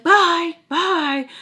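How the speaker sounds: high, loud mimicked dismissal; shouted goodbye. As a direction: belt out a mock dismissive bye. Mom's repeated goodbye acted out.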